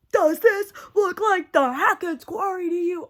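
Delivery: high, loud wailing game-line imitation; hammed-up high-pitched cry of indignation; mock outraged quote. Quoting the game's memorable lines.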